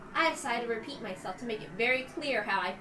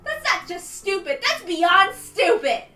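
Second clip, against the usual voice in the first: screaming, much higher and louder, through tears; tearful shouting; 8 semitones higher, 12 dB louder than usual. Acted. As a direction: rage and cry at the hag at once. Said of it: "My voice became hoarse from shouting".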